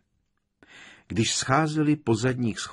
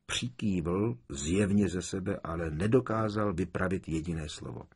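ordinary delivery

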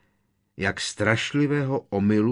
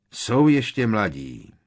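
drawn-out sorrowful voice; 5 semitones higher, 10 dB louder than usual. A narrator's reading. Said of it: "protáhle zarmoucený hlas".

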